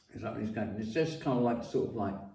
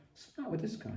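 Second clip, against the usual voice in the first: puzzled thought; 10 semitones higher, 10 dB quieter, much faster than usual. Retold or imitated. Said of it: "so I'm like".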